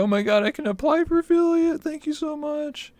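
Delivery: very high, gushing imitation; tiny high voice of an overwhelmed fan; overjoyed squeal. Imitating overjoyed new affiliate.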